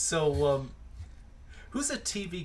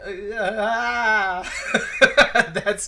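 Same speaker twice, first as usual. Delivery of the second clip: flustered laughing stammer, higher and louder; nervous laughing; 9 semitones higher, 9 dB louder, much slower than usual. Retold or imitated. Awkward refusal to a stranger.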